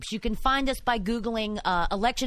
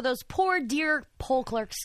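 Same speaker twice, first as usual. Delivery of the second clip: higher-pitched, pitying mockery; mock sympathy; 4 semitones higher, much slower than usual. Co-host mocking excuses made for poll clerks, mock sympathy. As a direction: feign pity for the poor dears.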